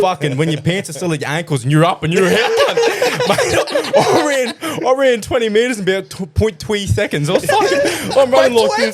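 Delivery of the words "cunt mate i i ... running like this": much higher, giggling as you tell it; laughing through it. Real, not acted. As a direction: laugh your way through the panic. Laughing through the spider story.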